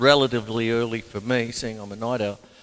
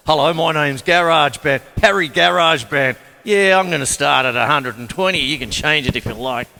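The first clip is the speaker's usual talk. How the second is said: louder, hammed-up friendly announcer bloke; GarageBand as a chatty bloke; 6 dB louder than usual. Drummer voicing GarageBand to viewers, hammed-up cheery bloke. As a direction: play the software introducing itself, loud and cheery.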